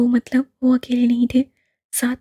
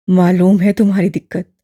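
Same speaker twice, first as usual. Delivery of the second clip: put-on low, louder elderly man, gently probing; lower, fuller old gentleman; old imam voice; 5 semitones lower, 7 dB louder than usual. A narrator's reading. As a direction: voice the old man, kindly and curious. Old man knowing reply.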